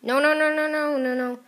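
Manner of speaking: high, loud, rapid run of no's; urgent string of high, loud no's; panicked no-no-no. Real, not acted. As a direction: cry out loudly as you're about to lose. Panicking while playing the game.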